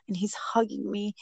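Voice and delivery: ordinary talk, nothing marked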